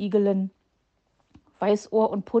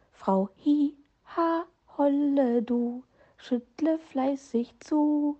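hohes, leises Piepsen eines kleinen Vogels; piepsend; 6 semitones higher, 3 dB quieter, slower than usual. A narrator's reading. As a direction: langsam und zart gepiepst, etwas höher. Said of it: "piepste dieser nur". (piepst leise und gedehnt wie ein Vögelchen)

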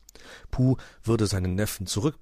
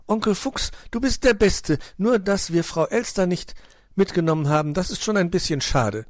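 high-pitched, eager little fellow; childlike, raised voice full of admiration; excited young nephew; 11 semitones higher, 5 dB louder than usual. Acted. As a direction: gush in a high voice, then ease into mild regret. Nephew praises uncle, then slight regret.

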